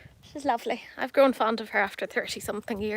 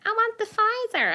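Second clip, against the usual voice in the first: put-on whiny kid voice; 11 semitones higher, 3 dB louder than usual. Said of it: "And he's like".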